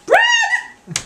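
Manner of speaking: very high, loud "Bread?"; shocked shriek. Acted. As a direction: screech it in disbelief.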